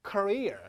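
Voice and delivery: Career as kuh-REER; stress on second syllable. Shown as a demonstration. Teacher modelling for students to repeat, Career as kuh-REER. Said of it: "강세 위치가 중요합니다".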